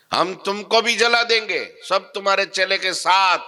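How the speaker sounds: very high, loud menace; raging threat. Retold or imitated. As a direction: scream threats of burning them. Madari threatens to burn everyone.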